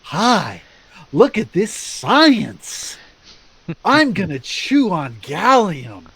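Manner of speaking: loud, slow, talking-down presenter voice; condescending YouTuber. Retold or imitated. Mocking a YouTube science host who talks down to viewers, loud slow patronizing voice. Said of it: "the people that are like".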